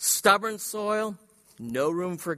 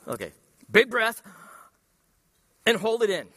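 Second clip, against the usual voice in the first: louder, higher coaching call; 5 semitones higher, 5 dB louder, faster than usual. Shown as a demonstration. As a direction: urge the room to breathe in and hold. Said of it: "Everybody take a big breath".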